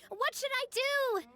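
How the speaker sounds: high, drawn-out imitated cry; retold yelling. Quoting what was overheard from the mountain, imitated yelling. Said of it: "someone yelling things like".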